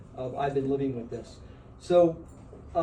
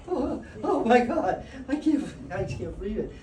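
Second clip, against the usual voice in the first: retold laughing-crying; 7 semitones higher than usual. Man retelling the pedicure pain to an audience, overwhelmed and high-pitched. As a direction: re-enact being overwhelmed, voice pitched up. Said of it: "I'm laughing and crying at the same time".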